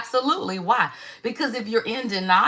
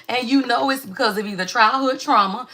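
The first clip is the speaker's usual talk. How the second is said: ordinary talk, nothing marked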